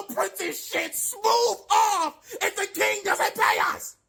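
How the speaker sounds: mock gleeful menace; laughing threat. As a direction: cackle while threatening.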